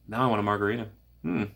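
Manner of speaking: ordinary talk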